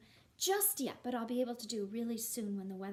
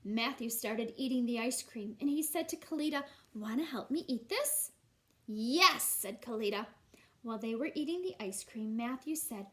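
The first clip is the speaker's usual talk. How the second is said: put-on lively, louder storybook reading for kids; reading to children; 5 dB louder than usual. A narrator's reading. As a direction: read it out like a teacher at story time. Reads picture book to class.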